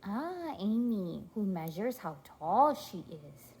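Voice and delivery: ordinary talk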